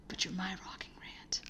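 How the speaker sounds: soft unvoiced whisper. Real, not acted. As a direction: breathe it like a secret compliment. Affectionate aside to co-host.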